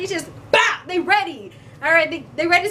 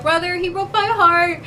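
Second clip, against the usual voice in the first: mock whine; 6 semitones higher than usual. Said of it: "be like".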